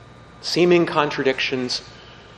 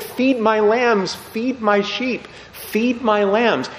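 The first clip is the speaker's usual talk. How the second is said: higher, slow and gentle; tender command; 8 semitones higher, slower than usual. Retold or imitated. Jesus entrusting Peter with his flock, slow and gentle. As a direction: give him the charge with tender trust.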